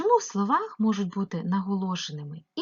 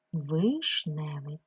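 "вишневий" slow and lower, broken into syllables; sounded out for spelling; 4 semitones lower, much slower than usual. Shown as a demonstration. Teacher showing how to write the word, syllable by syllable. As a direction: separate "виш" clearly from the rest of "вишневий". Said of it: "Пишу «і»".

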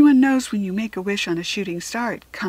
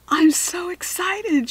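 high, slow gushing excitement; excited; 10 semitones higher, 3 dB louder, slower than usual. A narrator's reading. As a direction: bubble over with excitement. Suzanne bounding down, excited.

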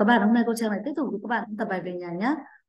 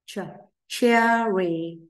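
Ch-, cherry broken up and stretched very slowly; teacher slowing down cherry; slow model word; 4 dB louder, much slower than usual. Shown as a demonstration. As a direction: sound out Ch-, cherry slowly for kids. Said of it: "Everyone say".

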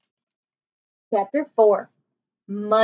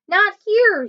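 high, louder warning shout; 9 semitones higher, 4 dB louder than usual. Acted. Annie shouting at the swimming wildebeest, high and louder. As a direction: wave them off with a high, loud shout of "Not here". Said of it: "she shouted".

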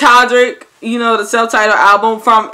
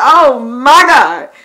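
slow stunned oh my god; 3 semitones higher, 5 dB louder, much slower than usual. Retold or imitated. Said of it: "I said".

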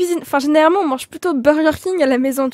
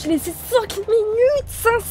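disbelieving; 6 semitones higher, 4 dB quieter, slower than usual. Acted. Mother in disbelief.

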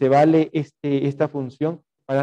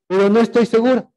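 high, quick acted dodge; flustered, hurried retreat; nervous backing out; 8 semitones higher, 3 dB louder, faster than usual. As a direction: blurt out the doubt in a higher voice. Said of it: "porque estábamos nerviosos".

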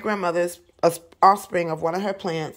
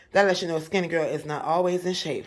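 usual speaking voice

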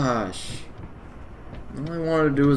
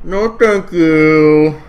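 loud slow refusal; 11 dB louder, much slower than usual. Real, not acted.

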